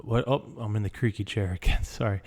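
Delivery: ordinary talk, nothing marked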